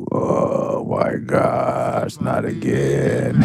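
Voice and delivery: slow, breathy mock groan of dread; two friends backstage dreading the encore, groaning; exasperated groan. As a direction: sigh it out like you're sick of it. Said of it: "me and Eli were backstage like".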